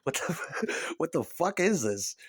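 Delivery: laughing through the question; mock outrage broken by laughter; laughing disbelief. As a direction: crack up while asking it. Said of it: "That's what I said when it came out".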